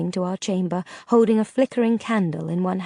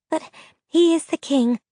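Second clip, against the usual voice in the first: trembling voice; 8 semitones higher than usual. A narrator's reading. Ciri fearfully reminds Vega of the king, trembling voice. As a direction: plead it nervously, voice quivering. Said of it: "said Ciri in a trembling voice".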